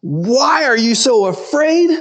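high, drawn-out mocking repeat; incredulous echo. Disciples quoting Jesus back at him, incredulous. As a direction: throw the question back high and slow.

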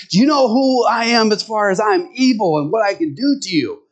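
lightly voiced devil making a threat; threatening Satan. Satan in the joke pressing the old man again, threatening.

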